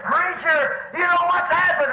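high, urgent call to the preacher; acting out an excited man bursting with news; excited news-bringer. Neighbour running up to the preacher with news, excited.

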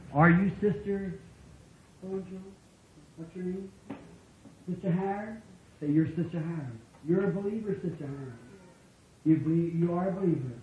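soft, gentle address to a sick woman; softly, as if at a sickbed; quiet demo. Shown as a demonstration. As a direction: show them how to speak it softly. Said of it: "Just come in like this and say".

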